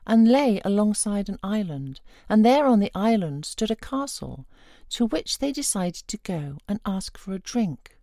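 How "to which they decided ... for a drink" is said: usual speaking voice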